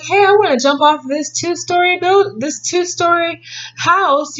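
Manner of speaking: put-on a kid begging to jump off; eager kid. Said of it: "If you were like".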